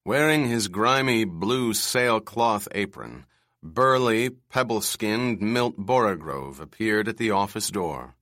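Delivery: plain reading voice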